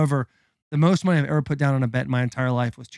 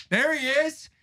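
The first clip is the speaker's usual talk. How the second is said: mock awe; 12 semitones higher, 4 dB quieter, slower than usual. Retold announcement of Hasbulla's arrival.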